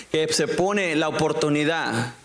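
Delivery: ordinary talk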